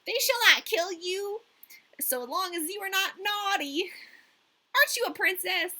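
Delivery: put-on high, loud bossy girl; robber girl. A narrator's reading. Robber girl hugs Gerda and questions her, high and loud. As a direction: claim her as a pet. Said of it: "She threw her arms around Gerda and said".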